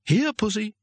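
high, sing-song cat call; coaxing call. A narrator's reading. Foolish ancient human calling a tiger, coaxing. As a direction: coax it like a house cat.

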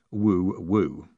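low mock owl woo; owl hoot. A narrator's reading. Moist signalling in the dark, mock owl hoot. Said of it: "made a noise like an owl".

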